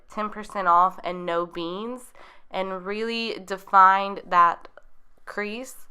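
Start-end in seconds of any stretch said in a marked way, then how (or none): none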